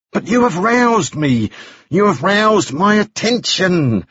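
high, slow, drawn-out words; eager prodding. A narrator's reading. Balfour tells Moody his attention is roused, eager and drawn-out. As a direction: press him with stretched-out, high-pitched relish.

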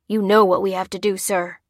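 plain reading voice

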